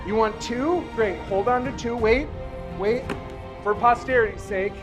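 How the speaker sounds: ordinary delivery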